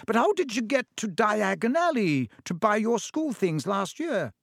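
high surprised mother. Acted. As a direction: raise it in disbelief.

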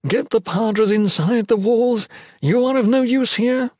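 high, tense urgent order; barely controlled voice. A narrator's reading. Leader sending the Padres inside, voice barely controlled. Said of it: "voice barely controlled".

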